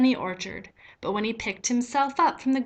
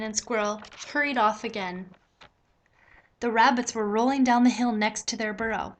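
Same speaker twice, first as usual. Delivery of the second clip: plain reading voice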